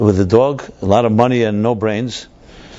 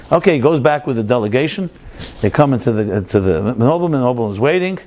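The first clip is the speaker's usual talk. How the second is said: ordinary talk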